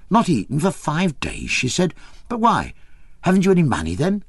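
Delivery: put-on high, incredulous peasant girl; horrified peasant girl. A narrator's reading. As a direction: disbelief rising to a squeak. Maria horrified.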